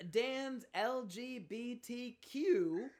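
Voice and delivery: slow, spelled-out segment announcer. Acted. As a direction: announce the segment like a show host.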